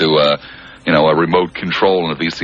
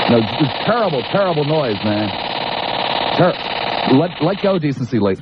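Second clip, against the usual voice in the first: raised voice over alarm; 8 semitones higher than usual. Real, not acted. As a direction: push the voice over a blaring siren. Shouting over the blaring alarm.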